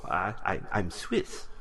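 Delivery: usual speaking voice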